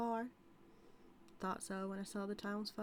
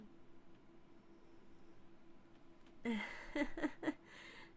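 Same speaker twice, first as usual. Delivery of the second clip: higher, breathy drawn-out laugh; long airy laugh; 7 semitones higher, 7 dB quieter than usual. Acted. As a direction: let a long amused laugh roll out.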